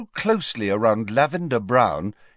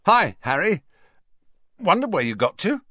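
higher, louder, falsely breezy; bravado and awkwardness; 9 semitones higher, 4 dB louder than usual. A narrator's reading. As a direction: bluff past the awkwardness. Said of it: "odd mixture of bravado and awkwardness".

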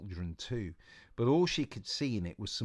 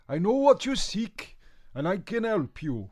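put-on slight Welsh accent; 11 semitones higher, 9 dB louder than usual. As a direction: announce yourself like a Welsh sage. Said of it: "developed a slight Welsh accent".

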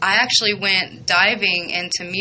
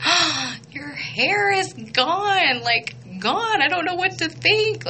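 high, slow stunned gasp of surprise; high pitched shocked disbelief; 9 semitones higher, slower than usual. Retold or imitated. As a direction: act floored by the new haircut. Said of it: "I was like".

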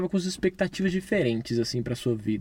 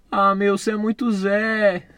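loud; 6 semitones higher, 7 dB louder, slower than usual. Acted. Comic aside to caller.

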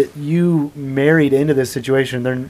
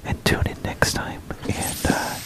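hushed sultry whisper; ASMR whisper; 10 dB quieter than usual. Pretend. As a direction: breathe the words softly. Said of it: "a little A S M R".

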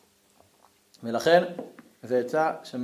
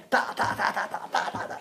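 mumbled filler insults; 6 dB quieter, faster than usual. Acted.

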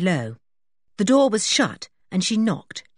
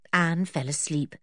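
ordinary delivery

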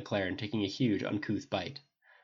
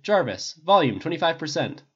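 louder, calling across the lab; calling out to Jarvis; 7 semitones higher, 8 dB louder than usual. A narrator's reading. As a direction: call the command out over the music. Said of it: "so he called".

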